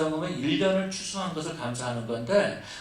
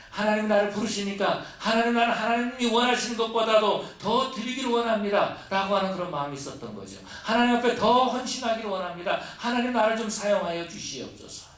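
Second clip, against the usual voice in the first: fervent prayer; 7 semitones higher, 4 dB louder than usual. Retold or imitated. Voicing a devoted prayer.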